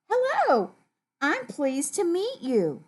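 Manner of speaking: high, lilting hello easing into slow politeness; kind greeting. Acted. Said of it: "is a kind thing to say".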